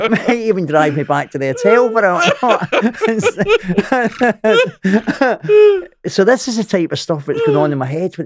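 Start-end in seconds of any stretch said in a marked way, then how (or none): 2.3-6.0 long high giggling laugh. Real, not acted. Man mocking his own hopes with a high laugh.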